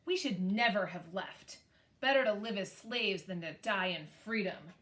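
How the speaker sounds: ordinary talk, nothing marked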